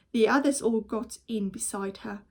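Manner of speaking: plain reading voice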